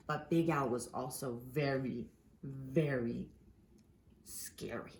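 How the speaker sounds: plain reading voice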